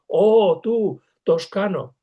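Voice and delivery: loud, slow hail from a risen ghost; calling out. Retold or imitated. Farinata hails Dante slowly and loudly. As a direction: rise up and call the fellow Tuscan over. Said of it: "Y se yergue y le dice".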